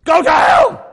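loud, high furious shout; angry shout. Pretend. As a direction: yell it at them in outrage. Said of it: "I'd say".

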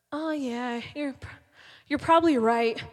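soft, hesitant agreement; half-swallowed admission of defeat; dejected giving in. Retold or imitated. Speaker gives in to taunts.

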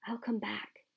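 breathy unvoiced whisper; a secret promise breathed to the mirror; fully whispered vow. A narrator's reading.

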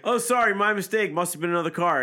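lightly voiced apologetic cop; mock cop apology. Podcaster imagining the cop's excuse, mock apology.

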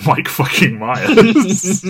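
loud, high, drawn-out and laughing; laughing disbelief. Real, not acted. Podcaster naming the surprise cast member, laughing in disbelief. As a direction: crack up as you say it.

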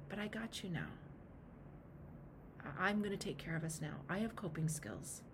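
soft, low reassurance; gently taking care; reassuring. Acted. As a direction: comfort a hurting part of yourself, softly. Reassures her inner alcoholic.

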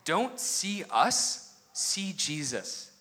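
slow, solemn preaching; solemn preacher. Retold or imitated. Friend Brandon to the wedding crowd, slow and solemn. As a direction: draw out each word like a pulpit call. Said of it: "just him saying".

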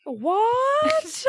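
high, squealed mock disbelief; mock shock. Co-host plays along with the joke, mock shock. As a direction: feign total shock at old news.

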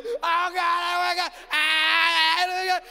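imitating a high, tearful scream; hammed-up hysterical wail. Dad imitating daughter in meltdown before the broadcast, screaming. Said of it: "And she is screaming".

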